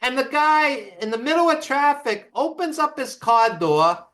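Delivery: ordinary talk, nothing marked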